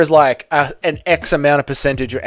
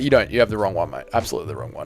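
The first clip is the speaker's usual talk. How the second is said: usual speaking voice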